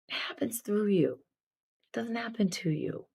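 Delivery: breathy whispered emphasis. Real, not acted. Speaker to viewers, driving the message home in a whisper. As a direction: drop to a hushed whisper to land the point. Intense message to live audience.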